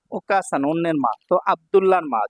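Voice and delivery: ordinary talk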